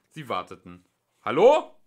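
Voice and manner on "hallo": very loud, high hello; questioning shout; calling out. A narrator's reading. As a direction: call to see if anyone is there. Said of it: "rief Ford".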